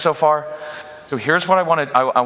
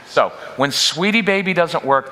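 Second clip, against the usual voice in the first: usual speaking voice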